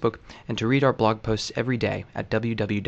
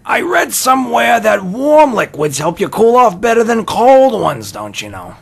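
much higher and louder Miller voice; Henry Miller impression; 12 semitones higher, 12 dB louder than usual. Son quoting Henry Miller to his dad, much higher and louder. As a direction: channel the old writer, hammed up. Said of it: "remembering Miller".

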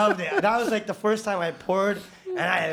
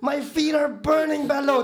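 higher, louder whiny protest; mock complaint; 9 semitones higher, 4 dB louder than usual. Rookie complaining to foreman about burning feet, loud mock. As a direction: complain to the boss. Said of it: "and I'm like".

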